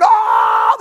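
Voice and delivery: high, loud joyful roar; crowd cheer. Retold or imitated. Crowd erupts at catch.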